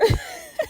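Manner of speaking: real high-pitched cackle at her own story; high wheezing laugh. Real, not acted. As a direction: crack up helplessly for a moment.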